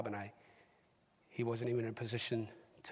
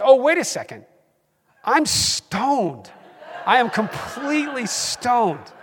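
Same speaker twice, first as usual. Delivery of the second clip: high, loud, laughing it out at the end; slowing into a laugh on completely stoned; giddy realisation; 13 semitones higher, 16 dB louder, slower than usual. Retold or imitated. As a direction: catch yourself being stoned and laugh. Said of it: "before I realized".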